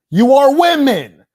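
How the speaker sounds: loud, slow, high-pitched yell of contempt; loud scornful shout; shouted insult. Real, not acted. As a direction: bellow it at them with scorn. Berating opposing viewers.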